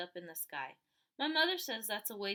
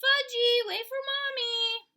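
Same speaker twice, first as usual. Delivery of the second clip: much higher, loud, drawn-out holler; 13 semitones higher, 11 dB louder, slower than usual. A narrator's reading. Mother hollering after Fudge running down the path. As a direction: call after a runaway toddler.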